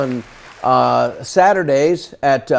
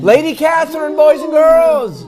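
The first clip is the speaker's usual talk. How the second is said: put-on a kids' show host's big welcome, much higher; announcer intro; 11 semitones higher, 3 dB louder than usual. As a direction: present her to the kids like a ringmaster. Host announces caller to kids.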